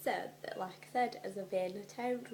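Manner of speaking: ordinary talk, nothing marked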